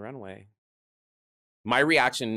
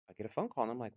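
normal talking voice

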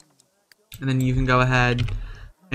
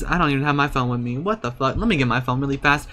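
ordinary talk

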